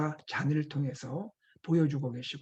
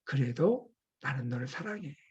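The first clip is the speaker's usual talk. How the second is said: soft, lowered tender voice; hushed, lower-pitched affection; loving reassurance; 4 semitones lower, 6 dB quieter than usual. Retold or imitated. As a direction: say it gently, as forgiving love. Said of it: "어떤 뉘앙스로 이 말씀하십니까".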